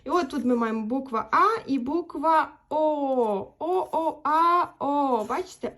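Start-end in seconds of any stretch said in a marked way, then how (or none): 3.6-5.2 О and А intoned one by one, each held; vowel demonstration. Shown as a demonstration. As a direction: chant О and А slowly for learners. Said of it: "Як я показую".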